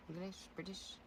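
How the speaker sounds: quiet English accent attempt. Shown as a demonstration. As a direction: try a British accent. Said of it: "You want me to do an English accent?".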